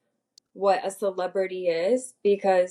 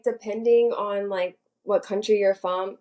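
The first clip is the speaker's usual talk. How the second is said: ordinary delivery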